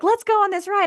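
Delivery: high-pitched quoted ride plea; eager imitation. Quoting a friend pushing for a ride, eagerly.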